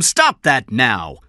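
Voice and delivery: slow, loud, high command; stern order. Acted. Boss silencing an angry detective, stern order. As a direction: order him to stop, each word weighted.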